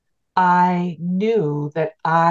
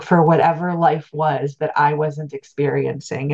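ordinary talk

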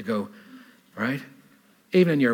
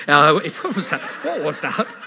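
laughing; 7 semitones higher, 8 dB louder, slower than usual. Real, not acted. Cracks himself up mid-story.

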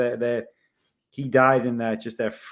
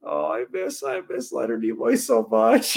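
hammed-up wistful sigh of a fan, pitched up high on the second line; mock heartfelt longing; 9 semitones higher than usual. Fan longing for Leonard Nimoy, hammed-up and wistful. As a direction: gush like a fan who misses him terribly. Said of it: "my heart was just like".

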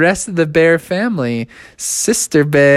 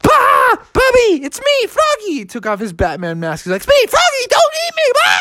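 panicked Froggy. Acted. Froggy panics at crazed bears.

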